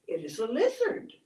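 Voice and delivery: plain reading voice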